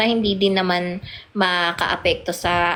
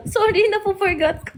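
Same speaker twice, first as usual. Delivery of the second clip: laughing apology; 13 semitones higher than usual. Real, not acted. Laughs at her own slip.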